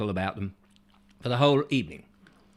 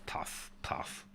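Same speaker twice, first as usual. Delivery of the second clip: whispered puffing; 16 dB quieter than usual. Acted. Miming puffing on a pipe.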